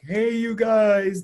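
loud, high, stretched-out movie holler; Goonies. Retold or imitated. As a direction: imitate a famous film yell, long and drawn out. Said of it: "the Goonies".

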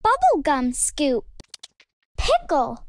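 ordinary delivery, nothing marked

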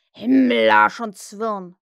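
very loud, slow oath; booming mimicked curse. A narrator's reading. Peter throws Hook's oath back at him, booming curse. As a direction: hurl the curse back, drawn out. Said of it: "gab die Stimme zurück".

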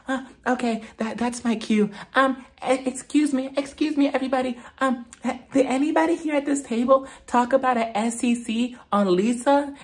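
high, overly polite dinner-party announcer voice; imitating Whitney.

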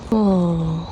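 ordinary delivery

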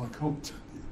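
hushed whisper, won't say it; secretive whisper. Retold or imitated. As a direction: whisper it as if it's rude. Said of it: "She said".

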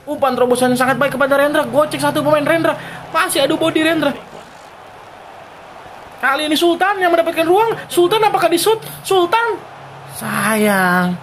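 fast, high excited call. Real, not acted. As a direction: build up to the shot. Chance near goal.

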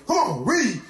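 ordinary talk

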